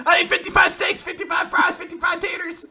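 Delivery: high shouted list of orders; frantic ordering. Retold or imitated. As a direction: yell out a huge order, item by item. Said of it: "she's like".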